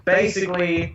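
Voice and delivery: normal talking voice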